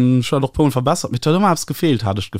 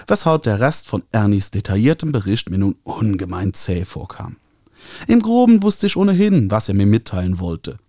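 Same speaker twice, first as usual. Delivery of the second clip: ordinary delivery, nothing marked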